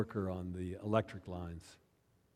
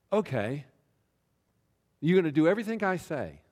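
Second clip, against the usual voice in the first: louder, higher and fast, daring him to agree; commanding challenge; 6 semitones higher, 6 dB louder than usual. Retold or imitated. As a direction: pastor challenging the recovering drinker, commanding and brisk. Pastor testing the man's resolve.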